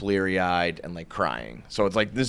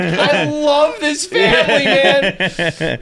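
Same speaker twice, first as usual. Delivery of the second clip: loud, high, laughing outburst; drawn-out hammed-up cry of love; gushing affection; 8 dB louder, much slower than usual. Retold gushing reaction amid laughter.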